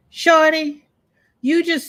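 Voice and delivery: loud, high call for attention. Real, not acted. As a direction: call out her name across the room. Calling out to a viewer.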